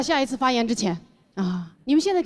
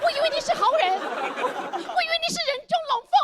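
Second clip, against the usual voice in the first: high, laughing foreigner voice; earnest foreigner with broken Mandarin; foreigner speaking mandarin. Retold or imitated. Thomas confronts shop owner, foreigner voice.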